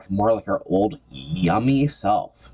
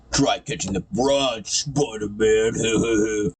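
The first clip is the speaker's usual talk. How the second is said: slow, higher cartoon villain voice; taunting villain; 3 semitones higher, much slower than usual. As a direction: taunt the hero, dragging out the last word. Voices villain tossing woman out.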